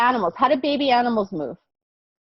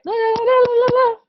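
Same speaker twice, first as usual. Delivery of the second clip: mock energetic singsong; 5 dB louder, much faster than usual. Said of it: "Are we like".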